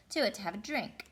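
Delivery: usual speaking voice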